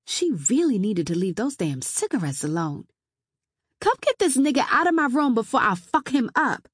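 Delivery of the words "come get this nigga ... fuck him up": high, loud, furious call through the house; raised, threatening holler; angry yell. Acted. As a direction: shout for someone to remove him. Yelling to housemate about the intruder.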